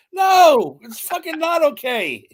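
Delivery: explosive high "no", then drawn out; outraged protest. Real, not acted. Rejecting the film's cannibal love story, loud outrage.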